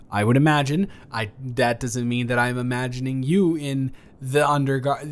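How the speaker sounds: usual speaking voice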